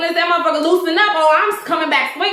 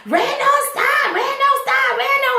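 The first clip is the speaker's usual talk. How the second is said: high pleading cries of stop, much higher pitch; a mother begging her son to stop, pitched way up; panicked mother yelling; 10 semitones higher than usual. Retold or imitated. Mother yelling at Randall, retold.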